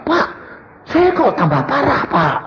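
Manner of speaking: congregant complains to pastor of getting worse, high-pitched plaint; high, plaintive mimicry of a complainer; complaining congregant. Pastor mimics someone bringing him their troubles, high complaint. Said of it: "ada orang cerita".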